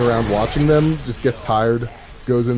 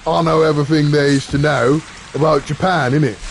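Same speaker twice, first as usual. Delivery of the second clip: louder mock British bloke, boasting; cheeky British lad showing off; hammed-up British argument voice; mock British banter; 3 semitones higher, 4 dB louder than usual. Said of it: "conversation between G-Wolf and G T F is like".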